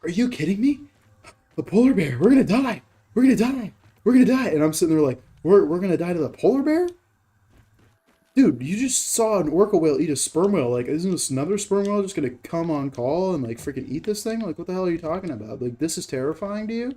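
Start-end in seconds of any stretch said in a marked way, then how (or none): 0.0-4.5 high, loud hammed-up freak-out; exaggerated panicked wailing, repeated in bursts; mock panic. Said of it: "starts freaking out, starts losing his shit". 5.4-16.9 higher-pitched exasperated disbelief; acted-out incredulous retort. Retorts to the panicking man.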